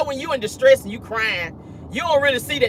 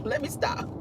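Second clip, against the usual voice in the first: whispered aside; 6 semitones higher, 11 dB quieter than usual. Real, not acted. Stops herself laughing.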